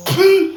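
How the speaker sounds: "pu" popped up high and short against a plain "pla". Shown as a demonstration. Drilling viewers on pu versus pla, "pu" squeaked high. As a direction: snap "pu" up high, keep it brief. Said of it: "sachez différencier la phonétique".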